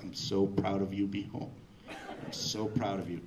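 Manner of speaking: low, tender inner voice; like a proud father talking to his boy, low; secretly proud. Retold or imitated. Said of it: "inside I'm going like".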